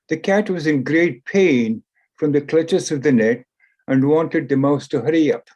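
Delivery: usual speaking voice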